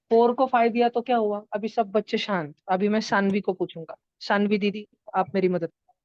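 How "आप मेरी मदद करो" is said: normal talking voice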